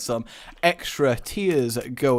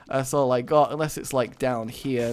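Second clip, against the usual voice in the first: ordinary delivery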